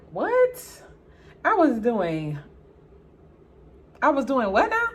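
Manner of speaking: loud, high-pitched disbelief; indignant, with stunned pauses; incredulous. Retold or imitated. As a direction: act shocked at the accusation. Acted shock at sister's accusation.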